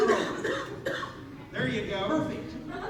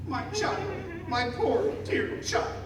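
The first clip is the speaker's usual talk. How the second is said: mock sobbing; 3 semitones higher, 4 dB quieter than usual. A narrator's reading. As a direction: lightly act a broken-up sob, word by word. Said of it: "while sobbing".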